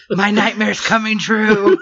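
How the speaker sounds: slow, laughing through the words; mock doom, cracking up; laughing mock dread. Imitating a sister's dread in the elevator, laughing and slow.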